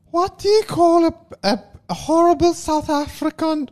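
high-pitched mock South African accent; exaggerated South African woman's voice.

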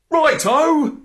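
high, loud "righto"; eager agreement. Acted. Dog takes the butterfly's order, eager high call. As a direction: sing out a keen yes.

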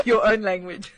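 laughing through the words; amused, breaking into laughter; giggling reply. Real, not acted. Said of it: "laughing reply".

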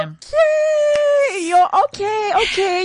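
high, drawn-out exclamation; surprised outburst. Real, not acted. Host reacting to co-host's marriage news, surprised. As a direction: react with a big high-pitched okay.

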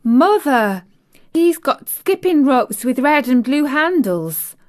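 put-on high, loud child's call to mother. A narrator's reading. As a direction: call out the exciting news. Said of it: "our Elizabeth Ellen called out".

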